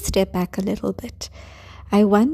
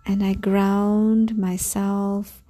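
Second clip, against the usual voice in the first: calm grounding exercise. Acted. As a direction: guide them gently into calm. Leads a grounding meditation.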